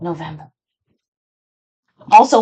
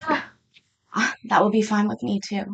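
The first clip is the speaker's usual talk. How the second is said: ordinary talk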